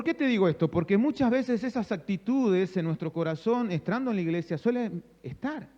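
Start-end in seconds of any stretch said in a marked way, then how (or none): none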